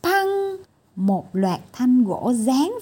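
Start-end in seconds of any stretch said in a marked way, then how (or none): none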